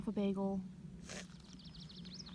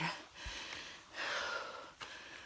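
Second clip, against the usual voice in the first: heavy sigh; 11 dB quieter than usual. Real, not acted. Stressed after hitting head.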